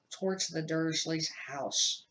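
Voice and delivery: usual speaking voice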